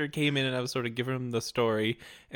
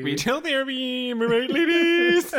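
hammed-up cheer; 10 semitones higher, 8 dB louder than usual. Joking retail therapy aside.

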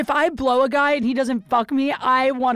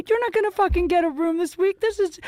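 retold angry outburst; 8 semitones higher than usual. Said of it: "I was so pissed".

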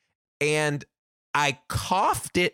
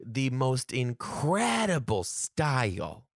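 ordinary delivery

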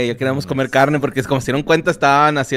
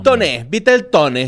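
"toné" slowed, landing hard on the last syllable; stress on final É; 3 dB louder, slower than usual. Shown as a demonstration. Spelling it out for a friend searching online, "toné" stressed on the É. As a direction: lean slowly on the final "é" of "toné". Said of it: "toné con acento en la E".

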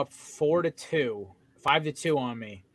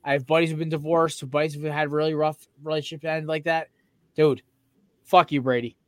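ordinary talk

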